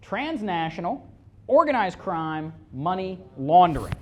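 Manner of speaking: slow emphatic declaration to the room; said like you mean it. Shown as a demonstration. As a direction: hammer out the charges. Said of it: "Say it like you mean it".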